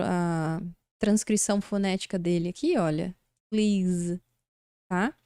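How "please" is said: please with long ee, voiced z ending; pronúncia de please. Shown as a demonstration. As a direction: draw out please into a buzzing z. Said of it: "tem esse sonzinho de Z". (please terminando com sonzinho de Z)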